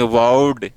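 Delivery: "वार्ड" as drawn-out "wawd", rounded aw; correct ward vowel. Shown as a demonstration. Teacher correcting students, "वार्ड" said with long aw. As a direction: stretch "वार्ड" with aw instead of aa. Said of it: "इसको वार्ड नहीं बोलेंगे".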